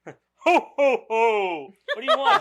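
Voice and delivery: giggling through a mock Santa; Santa Claus voice. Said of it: "you gotta go deeper with the voice".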